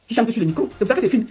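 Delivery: ordinary talk, nothing marked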